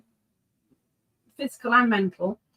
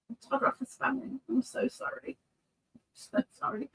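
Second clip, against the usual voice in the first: softer, quick, embarrassed apology; sheepish soft apology; 2 semitones higher, 12 dB quieter than usual. Real, not acted. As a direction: own up quietly and sheepishly.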